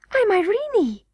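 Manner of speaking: put-on high, falling little-girl voice; young princess Irene, eager. A narrator's reading. Princess tells the hidden boy who she is, high-voiced.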